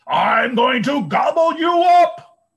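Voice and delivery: very high, loud troll shout; growling troll. A narrator's reading. Troll threatens the second goat, very high and loud. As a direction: bellow it like a greedy troll. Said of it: "the troll growled".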